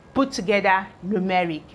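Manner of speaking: ordinary talk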